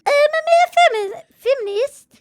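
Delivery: put-on failed Irish accent, squeakily high; Conor McGregor. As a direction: stumble through a high-pitched mock-Irish attempt. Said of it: "I can't do an Irish accent".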